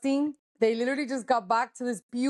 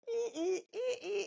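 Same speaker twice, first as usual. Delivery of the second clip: mock squeals; 8 semitones higher, 10 dB quieter than usual. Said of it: "everyone is just like".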